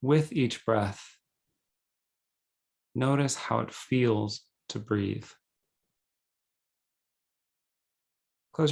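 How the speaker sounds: calm, measured meditation guide with long pauses; guided meditation. Acted. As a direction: guide them gently into breathing, unhurried.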